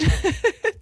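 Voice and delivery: a high, loud burst of laughter. Real, not acted. Host laughing as she tells how she tried to beat a train. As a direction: laugh out loud at your own reckless story. Laughing at her own teen car story.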